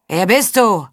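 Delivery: called-down challenge; barsche Frage von oben. A narrator's reading. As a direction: demand it from the wall. Torwächter fragt von der Mauer herab. (barsch herabgerufene Frage)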